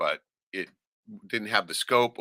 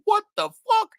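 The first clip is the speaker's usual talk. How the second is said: a much higher, louder startled cry; shocked; 5 dB louder than usual. Retold or imitated. Son seeing the salmon footage, startled high cry. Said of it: "I'm just going".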